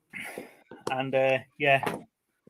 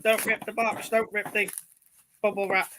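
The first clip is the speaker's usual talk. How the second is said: lightly put-on, high scolding voice; mock shouting wife; 6 semitones higher than usual. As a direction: imitate a wife telling you off, without shouting. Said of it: "She's probably shouting at me now".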